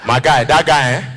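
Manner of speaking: ordinary talk, nothing marked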